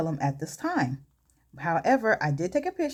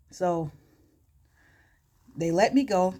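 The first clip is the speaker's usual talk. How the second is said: plain reading voice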